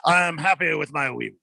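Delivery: a grinning mock Frenchman, jolly; French accent. Host clowns as French prosecutor, in a cheery fake French accent. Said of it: "I say yes in France".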